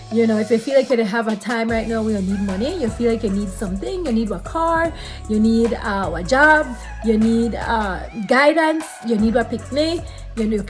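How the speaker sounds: ordinary delivery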